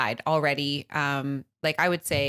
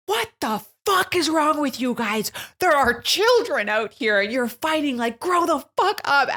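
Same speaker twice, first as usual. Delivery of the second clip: high, loud imitated yelling at kids; retold yelling; 9 semitones higher, 5 dB louder than usual. Mom yelling at fighting teens in parking lot, high and loud. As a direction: mimic a furious mom yelling, pitched high. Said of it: "All I hear is Holly being like".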